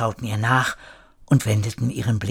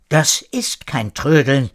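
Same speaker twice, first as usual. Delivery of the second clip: ordinary delivery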